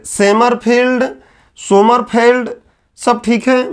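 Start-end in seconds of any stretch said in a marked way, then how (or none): 0.1-1.0 "सेमरफील्ड" as se-mar-feeld; variant सेमरफील्ड. Shown as a demonstration. Teacher listing accepted variants, "सेमरफील्ड" with an e first. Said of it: "अलग अलग मिल जाता है". 1.6-2.5 "सोमरफेल्ड" as so-mar-feld, no long ee; variant सोमरफेल्ड. Shown as a demonstration. Teacher listing accepted variants, "सोमरफेल्ड" ending feld. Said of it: "अलग अलग मिल जाता है".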